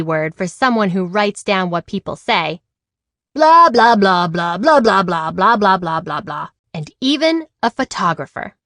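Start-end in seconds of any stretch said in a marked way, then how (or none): 3.3-6.5 bored, sing-song chatter of talk; mock the endless talking; mocking blah blah. Amelia mocks what people say as stenographer, sing-song.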